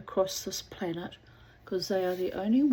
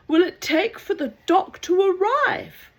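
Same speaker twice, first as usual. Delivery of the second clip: desperate call; 12 semitones higher, 9 dB louder than usual. Acted. Drowning man asks.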